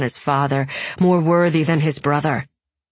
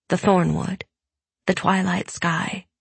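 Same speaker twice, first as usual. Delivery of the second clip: usual speaking voice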